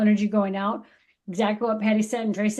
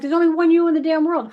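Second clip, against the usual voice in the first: higher, louder and faster, full of conviction; excited urging; 6 semitones higher, 6 dB louder, faster than usual. Retold or imitated. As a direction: push it out like a rousing pep talk. Soul's voice urging you.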